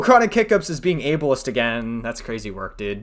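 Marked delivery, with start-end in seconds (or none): 0.0-2.0 mock shock. Streamer calls out a clip as ableist, mock shock. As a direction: cut in with hammed-up outrage.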